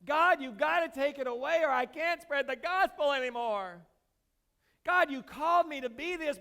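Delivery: loud, desperate plea to God; Paul begging God to take it away; pleading with God. Retold or imitated. Preacher acting Paul pleading loudly with God. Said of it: "he's pleading with God".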